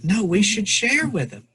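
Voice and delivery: retold as a child's eager objection; earnest protest. Boy urging his father to share supplies, protesting. Voicing the boy's pleading protest.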